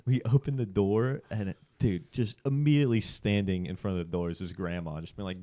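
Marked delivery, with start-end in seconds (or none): none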